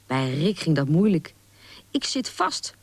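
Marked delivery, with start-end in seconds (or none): 1.9-2.7 high, mildly panicked squeak of a voice; anxious cry. A narrator's reading. Young squirrel stuck in his sweater, anxious. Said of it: "riep hij benauwd".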